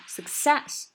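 "success" stressed at the end, slow; stress on the second syllable. Shown as a demonstration. Teacher drills the stress on "success" for students. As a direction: hit "-cess" in "success". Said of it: "重音在后面".

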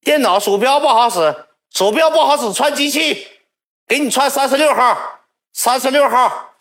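high, loud dismissive reply; attendant shouting back. Retold or imitated. Attendant yelling from downstairs to swap machines, loud. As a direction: call back up the stairs, loud.

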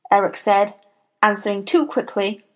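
plain reading voice

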